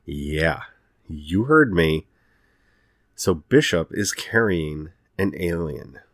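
normal talking voice

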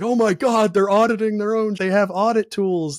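imitating thrilled amazement, high and loud; amazed reaction. Auditor voicing delight at self-auditing staff, amazed. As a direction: gush it high and loud, mock-astonished.

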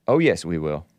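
deep mock retort. Host repeats the retort in a low mocking voice. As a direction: answer back in a low, gloating mock voice.

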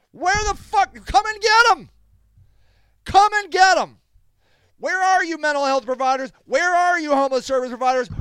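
high, loud challenge to the critics; furious yelling. Real, not acted. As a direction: yell it out, really fed up. Furious rant challenging critics to act.

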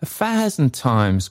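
high, eager character voice; crying out. A narrator's reading. Trotty answers the bell, crying out. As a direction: cry it out high. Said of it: "cried Trotty".

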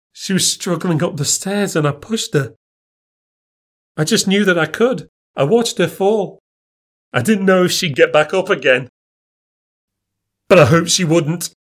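high-pitched, agitated confession; distraught confession. Acted. Greg's tearful confession of pushing her.

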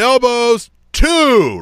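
high, loud sideline holler; re-enacted high yell; yelling out a count. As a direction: call the tally out to the referee. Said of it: "I'm yelling them out".

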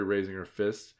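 ordinary delivery

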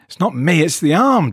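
panicked plea to the doctor; cried out. Acted. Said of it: "Jeff cried".

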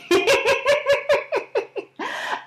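real high burst of laughter. Real, not acted. Laughing at own story.

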